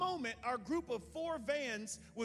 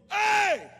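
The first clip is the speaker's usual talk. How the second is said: loud, high hey; stranger's sharp call; sharp yell; 12 semitones higher, 17 dB louder than usual. Retold or imitated. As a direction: bark it across the street. Stranger yells from bike.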